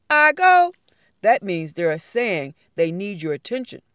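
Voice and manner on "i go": high loud call. A narrator's reading. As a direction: call it out to the room. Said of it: "When someone calls out".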